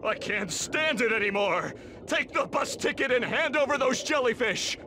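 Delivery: pitched high in exasperation; worn-down, high-pitched outburst; exasperated. Acted. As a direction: snap at the end of your patience. Larry gives in, exasperated.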